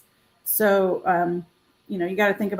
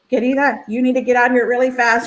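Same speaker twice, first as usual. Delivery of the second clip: mock urgent, a bit higher and louder; urgent retold friend; 4 semitones higher, 6 dB louder than usual. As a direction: hurry her along like a pushy friend. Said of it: "I'm laughing 'cause of the way you're like".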